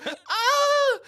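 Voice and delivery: very high, loud mock scream; panicked shriek. Rider startled by his yelling, high panicked scream. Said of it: "in tune with my panic".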